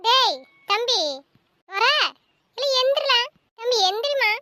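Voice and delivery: high, loud wake-up call; urgent calling out. Acted. Sister waking sleeping brother, urgent loud calling. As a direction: call loudly to rouse a sleeper.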